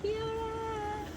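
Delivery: high, slow cooing at a puppy. Real, not acted. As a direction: coo softly at the dog. (강아지에게 하는 애교 섞인 말투)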